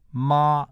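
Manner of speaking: "ma" held on a high, level pitch; an extra high tone on "ma"; Mandarin first tone. Shown as a demonstration. Lecturer demonstrating Mandarin tone number one, high "ma". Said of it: "is an extra high tone".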